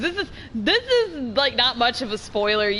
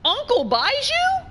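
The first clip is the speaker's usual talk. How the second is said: higher-pitched baffled double take, recalling a reaction; taken aback; 12 semitones higher, 3 dB louder, slower than usual. Retold or imitated. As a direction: replay your confused disbelief, voice jumping up. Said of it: "'cause I was like".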